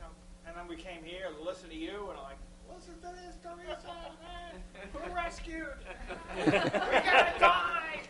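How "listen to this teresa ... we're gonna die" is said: high, hammed-up giddy cheer to his wife; giddy mock cheer. Husband joking to Teresa in the car, high giddy cheer. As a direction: squeal it like a giddy joke.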